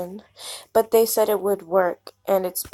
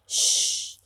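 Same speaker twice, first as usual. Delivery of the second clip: soft unvoiced shush; hush; 3 dB quieter than usual. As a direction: hush them gently. Hushing someone.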